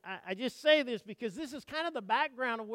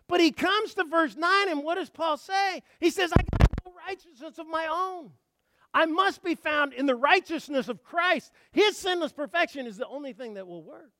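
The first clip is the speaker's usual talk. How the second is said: raised, driving pulpit voice; full-force preaching; 7 semitones higher, 8 dB louder than usual. Acted. Preacher declaring Paul's words to the congregation, full-force preaching. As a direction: preach it high and urgent.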